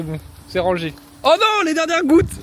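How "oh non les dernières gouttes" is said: high, slow wail of dismay; dismayed cry. Real, not acted. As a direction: cry out in comic alarm. Last drops, dismay.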